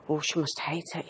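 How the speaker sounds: hushed whisper; worried whisper. Retold or imitated. Retold worried private remark.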